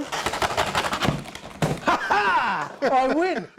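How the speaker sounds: gloating laugh then a cry of victory; triumphant laugh. Real, not acted. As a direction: crow over winning the race. Hacksaw race won, gloating.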